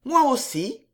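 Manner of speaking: a quick lifted reply in a lady's voice; Riquette. Acted. Woman character answers in staged chat.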